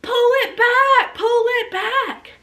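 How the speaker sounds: high, slow pull it back; urgent calling. Retold or imitated. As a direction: call out slowly and high.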